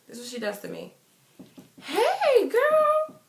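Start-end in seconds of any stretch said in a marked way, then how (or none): 1.8-3.2 high-pitched, drawn-out fake-sweet hey; imitating a hollow hello, pitched way up; mock greeting. Girl imitating an old friend's greeting after a year apart, mock greeting.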